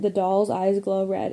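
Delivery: ordinary delivery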